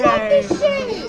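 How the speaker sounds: high, slow gleeful squeal; thrilled squeal; excited. Real, not acted. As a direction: gush with delight. Excited reaction.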